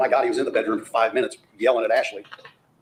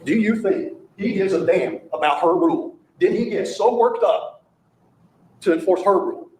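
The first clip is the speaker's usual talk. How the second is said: incredulous raised pitch, slow emphatic words; indignant; 8 semitones higher, 4 dB louder, slower than usual. Real, not acted. Lawyer challenges jury on the defendant's motive, indignant. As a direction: drive each word home, indignantly.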